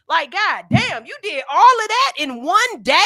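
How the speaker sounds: high, loud amazed disbelief. Real, not acted. Host amazed at her own day's to-do list. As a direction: marvel at all that got done.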